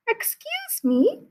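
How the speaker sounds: put-on high, soft sweet old lady; a sweet little old woman at the door; brightly. Old woman politely greeting Mister Cream, sweet and high. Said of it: "she said brightly".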